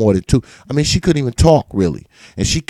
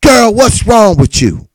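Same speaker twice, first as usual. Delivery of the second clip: mother's dismissive question; 8 semitones higher than usual. Retold or imitated. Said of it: "She said".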